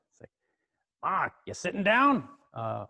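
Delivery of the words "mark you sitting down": high, slow New York phone voice; New York accent. Retold or imitated. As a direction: build suspense as a New York mechanic. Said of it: "this kinda New York accent".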